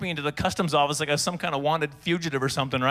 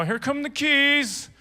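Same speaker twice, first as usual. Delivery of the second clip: high, drawn-out slow delivery; exaggerated slow motion; 9 semitones higher, much slower than usual. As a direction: stretch it out as if moving very carefully. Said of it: "I hand him the keys slowly".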